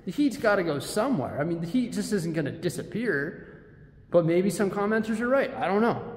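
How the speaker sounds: ordinary talk, nothing marked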